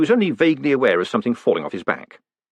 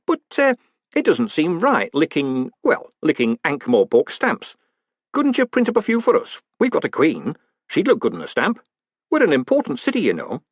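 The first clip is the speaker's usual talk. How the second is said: high, hesitant small-town mayor; hedge, then plead with lifted pitch; wheedling mayor; 6 semitones higher than usual. A narrator's reading. Mayor asks postmaster for the town's own stamps, wheedling.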